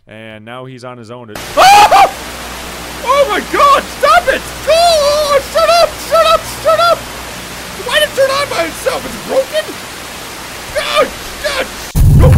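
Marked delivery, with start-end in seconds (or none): 1.4-12.3 very high, very loud panicked shrieking; real terrified screaming. Real, not acted. Real scare from device.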